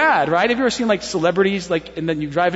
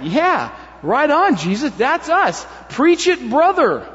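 rising, higher-pitched amens from a crowd; crowd cheering approval; 6 semitones higher, 3 dB louder, slower than usual. Acted. Townspeople egging Jesus on, hearty approval. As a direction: cheer the preacher on, building to the end.

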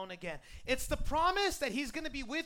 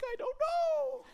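high-pitched, sing-out answer; mock-clueless, pitched way up; playful shrug; 14 semitones higher, 6 dB louder, slower than usual. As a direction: toss it up high as a cheerful non-answer. Acted answer to puzzled coworkers.

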